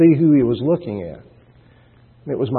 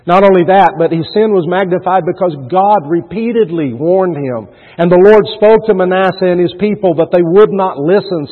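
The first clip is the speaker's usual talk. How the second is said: preaching; 5 semitones higher, 5 dB louder than usual. Acted. Preaching rising in force.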